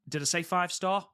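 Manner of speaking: catching own slip, pitch jumps up; surprised self-correction. Real, not acted. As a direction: realize the mistake mid-sentence. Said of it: "I meant five minute".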